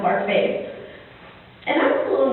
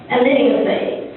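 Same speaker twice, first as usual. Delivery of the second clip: usual speaking voice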